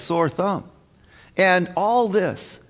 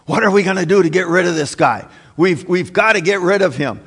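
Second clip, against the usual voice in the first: hurried, louder panicky chief priests; acted panic; 4 dB louder, faster than usual. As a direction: blurt it out as if panicking. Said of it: "there is panic".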